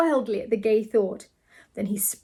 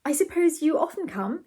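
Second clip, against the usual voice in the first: envious, higher-pitched probe; a touch of envy; 7 semitones higher than usual. A narrator's reading. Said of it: "in a tone of envy".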